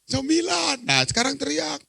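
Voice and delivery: high, calling instruction; called-out count. Acted. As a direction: call it out like cueing a scream. Awak kapal menghitung lalu menyuruh teriak. (aba-aba bernada tinggi)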